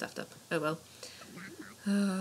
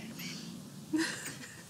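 high giggles trailing off softly; helpless laughter; 9 semitones higher, 7 dB quieter than usual. Real, not acted. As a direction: lose it in a giggling fit. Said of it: "I'm laughing at myself".

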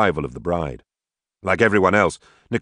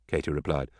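ordinary delivery

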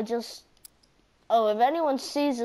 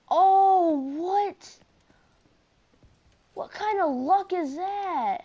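shocked disbelief; 6 semitones higher, slower than usual. Real, not acted. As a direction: cry out in disbelief at bad luck. Gamer reacts to a bad death.

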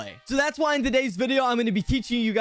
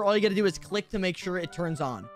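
ordinary delivery

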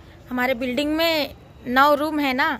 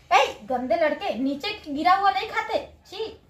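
scolding; 6 semitones higher than usual. Real, not acted. Scolding son for eating off floor.

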